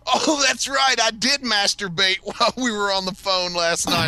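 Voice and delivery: high-pitched, hammed-up blurt into the phone; excited mock phone voice. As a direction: blurt it out for the whole restaurant. Acting out loud call before diners.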